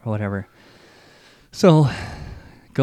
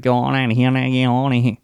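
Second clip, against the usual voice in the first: put-on Louisiana accent; 3 semitones higher, 4 dB louder, faster than usual. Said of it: "He's doing the voice".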